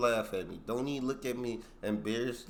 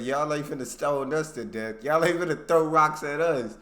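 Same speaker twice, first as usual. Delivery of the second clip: defiant soldiers; 5 semitones higher, 10 dB louder than usual. Retold or imitated. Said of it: "They said".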